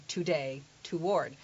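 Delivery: "today" and "toward" starting with full too; over-careful learner's to. Retold or imitated. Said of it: "people who learn English as a second language always say".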